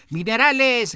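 hammed-up crowd yell; shouted back. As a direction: yell it back at the other side. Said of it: "gritaron otros tantos".

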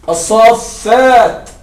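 الصافات said very slowly as "as-saaffaat", long a held into a doubled f; stretched long a and double f. Shown as a demonstration. Teacher shows students two silent sounds meeting in one word. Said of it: "الساكن، الألف ساكنة".

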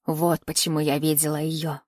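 put-on low old seer's voice; cryptic old woman. A narrator's reading. (низкий голос старухи-провидицы)

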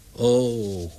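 a moaning sigh from a despairing pigeon owner; groaned lament. A narrator's reading. Larry moaning up at his bird on the telegraph pole, groaning. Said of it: "had men Larry horen kreunen".

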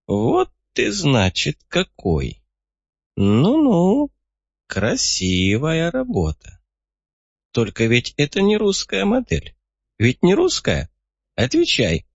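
put-on high, prodding old codger; a sly frail old man demanding. A narrator's reading. Old man John speaks.

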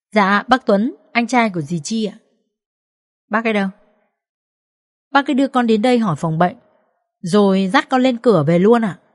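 a hint of a polite small child; lightly childlike, earnest reporting; lightly voiced little boy. A narrator's reading. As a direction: answer mother dutifully, like a young son.

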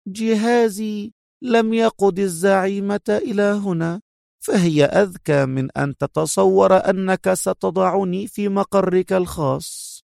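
high-pitched, superior woman's voice; smug woman captive. A narrator's reading. Captive woman explaining her leader's cleverness to her captor, smugly. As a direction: lilt it high, sure of having won. Villainess figure voiced in narration.

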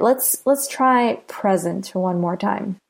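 usual speaking voice

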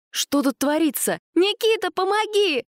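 high panicked call; calling for help. A narrator's reading. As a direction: scream for help.

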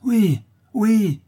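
very high, loud assent; crowd crying out. A narrator's reading. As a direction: shout agreement like an aroused crowd. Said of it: "s'écriaient les laboureurs". (cris aigus d'approbation)

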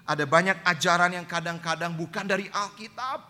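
high, loud sermon push; impassioned preaching. Acted. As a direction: preach it full force to the church. Preaching at full force. (khotbah berapi-api, tinggi dan keras)

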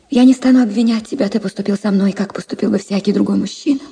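ordinary delivery

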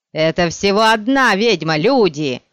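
loud ringing man's call; booming metallic voice. A narrator's reading. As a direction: call out to the crowd. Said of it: "звучный металлический голос".

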